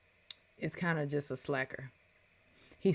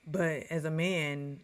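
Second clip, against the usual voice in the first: usual speaking voice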